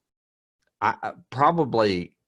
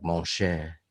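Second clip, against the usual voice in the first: mock French; 2 semitones lower, 5 dB quieter than usual. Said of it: "saying a French word like".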